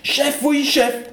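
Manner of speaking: high, slow dim-witted soldier voice; gung-ho soldier. Acted. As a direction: play the eager simple-minded private. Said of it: "Vous excuserez la scène suivante".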